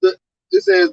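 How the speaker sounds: usual speaking voice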